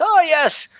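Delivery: high, fluttery voice of an ancient elven woman; flustered old elf scribe. Acted. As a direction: play a scatterbrained old elf surprised by visitors. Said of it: "and she says".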